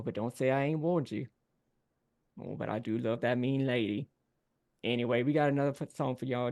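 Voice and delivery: mock-wounded Southern showman; folksy Cajun band frontman, teasing. Frontman complaining about his mean lady, teasing and folksy. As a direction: play the heckle up for the crowd.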